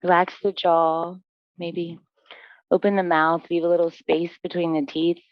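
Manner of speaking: low, even meditation voice with long pauses; guided relaxation. Real, not acted. Yoga teacher guiding students into breath and stillness, calm guided relaxation.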